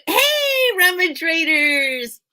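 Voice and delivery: high, drawn-out hail to the crowd; big high-pitched shout of welcome; calling out a greeting. Real, not acted. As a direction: holler hello, loud and stretched out.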